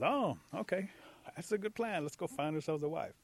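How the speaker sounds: high, lively voice of a rabbit; eager agreement. Acted. Rabbit agreeing to go wife-hunting, high and eager.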